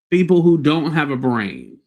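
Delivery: ordinary talk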